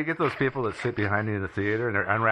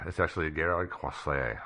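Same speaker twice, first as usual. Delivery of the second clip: French accent; 6 semitones lower, 5 dB quieter than usual. Shown as a demonstration. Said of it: "This is why I don't do accents".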